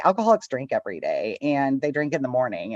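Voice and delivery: usual speaking voice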